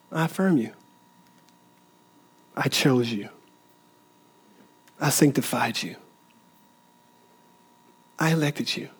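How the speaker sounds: softly voicing God's affirmation to listeners; tender voice of God. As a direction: speak it like a loving father. Preacher voices God's tender words.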